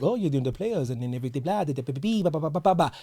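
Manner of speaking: dismissive parody of his excuses; imitating the manager at the microphone, mock; mocking the coach. Mocking the coach's press talk.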